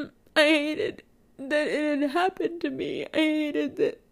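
crying. Real, not acted. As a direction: push through real tears. Crying while confessing hurt.

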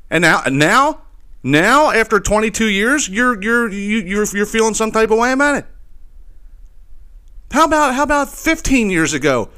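high-pitched, exasperated disbelief; incredulous ranting. Real, not acted. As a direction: challenge him as if it's absurd. Ranting at the actor's late objection.

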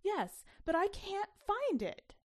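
high, loud, slow panicked cry; voice rising high. A narrator's reading. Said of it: "Mommy's voice rose very high".

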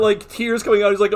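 ordinary delivery

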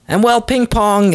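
ordinary talk, nothing marked

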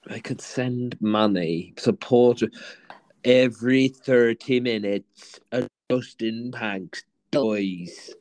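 low, slow, solemn mock appeal; mock charity appeal. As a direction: plead like a charity advert, drawn out.

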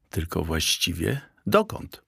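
plain reading voice

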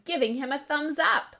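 plain reading voice

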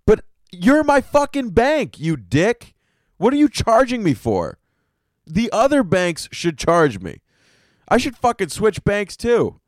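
real indignation, pitch climbing on fucking bank; genuinely fed up, loud and high complaint; angry rant. Real, not acted. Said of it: "I don't know what I'm so angry about today".

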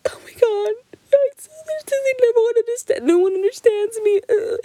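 high, hammed-up teary wail; melodramatic crying. Teen drama movie scene after a fight, mock crying. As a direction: sob it like a teen drama heroine. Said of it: "just, like, really crying".

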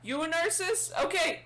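high-pitched questioning repeat; guard's voice. Acted. Guard taking down the stranger's name, high flustered voice. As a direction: echo it high, as a flustered official.

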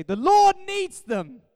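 slow, high, very loud proclamation; mock-dramatic shout. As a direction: holler it as an over-the-top excuse. Comic retake as excuse to shoppers.

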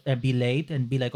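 ordinary talk, nothing marked

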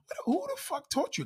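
high, shocked mock outrage; shocked outburst. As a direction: act stunned at the kid. Acting out shocked parent.

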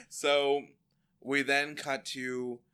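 normal talking voice

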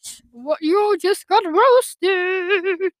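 1 mock taunt. Real, not acted. Teasing brother.

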